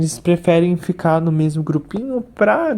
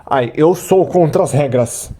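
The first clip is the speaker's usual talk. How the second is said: mock rebel voice. Fish voiced as rule breaker.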